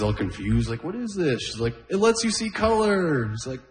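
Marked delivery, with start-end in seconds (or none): 0.8-1.4 lightly voiced confused old man. 1.9-3.3 lightly voiced granddaughter. Granddaughter explaining.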